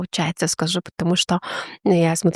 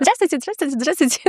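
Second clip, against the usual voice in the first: high, bright run of hellos, one after another; lightly voiced eager children saying hello; cheerful kids greeting; 7 semitones higher than usual. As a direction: mimic children greeting everyone they pass. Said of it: "привычка здороваться со всеми подряд".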